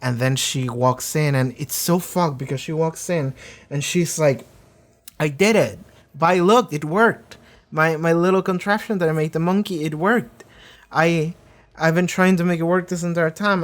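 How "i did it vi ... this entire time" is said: put-on excited child. Jinx proudly shows her invention.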